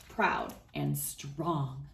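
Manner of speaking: ordinary delivery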